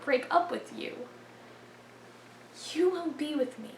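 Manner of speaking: very soft, broken by long pauses; hushed poem recitation. Acted. Performer vowing never to break up with forensics, hushed recitation. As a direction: let it sink almost to a whisper.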